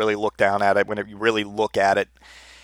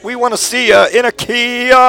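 much higher, louder Kermit-style puppet yell; Muppet voice; 12 semitones higher, 5 dB louder than usual. Retold or imitated. Mocking the Kia Muppet spokesperson, much higher puppet yell. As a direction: squeak it out like a creepy Muppet. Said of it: "the Muppet does the whole Kermit the Frog shake".

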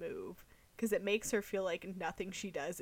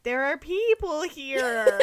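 high, loud and drawn-out mom impression; hammed-up mom asking, high and slow; mock mom voice; 7 semitones higher, 12 dB louder, slower than usual. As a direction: stretch it out loudly as an exaggerated mother. Mocking voice for the mom.

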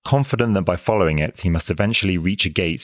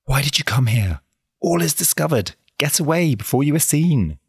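put-on high, urgent girl's warning; hurried high warning; excited whisper; 9 semitones higher than usual. A narrator's reading. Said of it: "exclaiming as she did so in an excited whisper".

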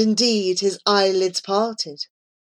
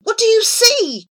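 high, loud eager question; begging; 6 dB louder than usual. A narrator's reading. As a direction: demand an answer, pleading. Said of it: "begged Abu".

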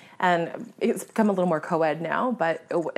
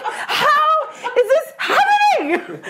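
very high, drawn-out comic wail; wailing disbelief; 5 dB louder, much slower than usual. Retold or imitated. Hunter as the shot bird flies again, wailing in disbelief. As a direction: wail it slowly and loudly.

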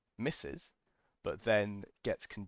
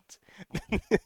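high, loud cackling laugh; real helpless laughing fit; loud burst of laughter; 11 semitones higher, 9 dB louder than usual. Real, not acted. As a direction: let the laugh explode out. Cracking up at the story.

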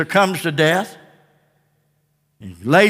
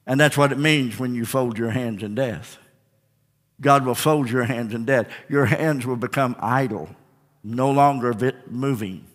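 hushed preaching; 9 semitones lower, 7 dB quieter than usual. Acted. Preacher hushed before the congregation, low and soft. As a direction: speak quietly about death. Quiet opening on death.

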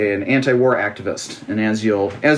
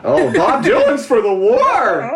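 mock shocked gasp; 10 semitones higher, slower than usual. Mocking shocked public reaction.

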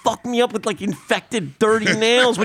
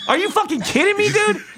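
higher-pitched indignant rant; mock outrage; 7 semitones higher than usual. Yelling at a cyclist on the sidewalk, mock outrage. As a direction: act out berating a stranger.

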